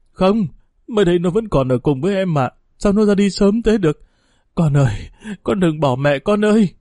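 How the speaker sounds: grieving wail. A narrator's reading. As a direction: beg the child not to leave. Mother grieving dead daughter.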